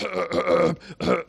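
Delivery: put-on throaty coughing fit; sore-throat cough. Showing kids a sick person's symptoms, pretend sore-throat cough. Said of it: "you had a sore throat".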